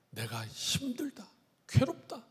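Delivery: whispered, worn-out lament; exhausted whisper as God; weary whisper. Acted. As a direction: breathe it out like someone too tired to go on.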